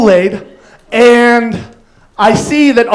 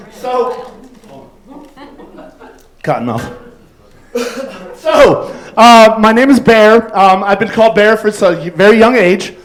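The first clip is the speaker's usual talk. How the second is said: ordinary delivery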